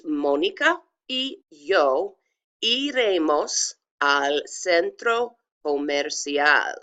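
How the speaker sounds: slow, separated Spanish words; pronunciation demo. Shown as a demonstration. Teacher modelling the nosotros sentence, slow careful demo. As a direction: sound out "Mónica y yo iremos al centro comercial" for learners. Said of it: "pronounce them for you".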